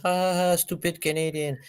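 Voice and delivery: high-pitched imitation of a taunt; mocking jeer. Mimicking Americans insulting Canadians.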